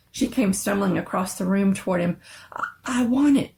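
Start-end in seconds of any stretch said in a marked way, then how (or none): none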